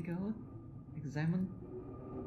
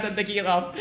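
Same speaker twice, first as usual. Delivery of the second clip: usual speaking voice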